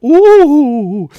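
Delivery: high loud cartoon ooh; surprised ooh. Letters with umlauts pictured as a face, high surprised ooh. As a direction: squeal out an "Ooh" like a shocked face. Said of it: "a face going".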